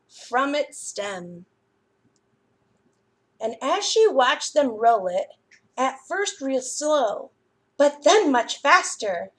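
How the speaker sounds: lively, expressive storytime read-aloud; reading to children. A narrator's reading. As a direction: read it like a librarian to little kids. Reads picture book to children.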